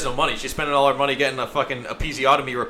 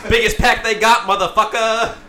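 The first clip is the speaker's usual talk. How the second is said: mock brash woman; 7 semitones higher, 6 dB louder than usual. Voices the woman in a joke.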